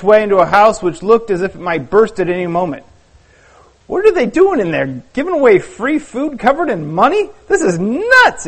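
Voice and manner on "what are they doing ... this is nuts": exclaiming. Acted. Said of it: "exclaimed Simon".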